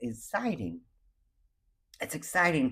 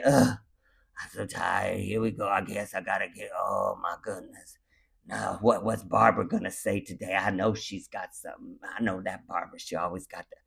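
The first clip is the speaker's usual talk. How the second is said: grumpy and tired; 6 semitones lower than usual. Pretend. Waking up choosing dread, muttering about Barbara. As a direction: drag yourself out of bed complaining. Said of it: "Or you can choose to say".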